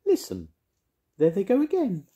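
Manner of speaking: high, loud fairy voice; insistent high-pitched fairy. A narrator's reading. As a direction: pipe up urgently as a fairy. Fairy figure urges Arthur to listen.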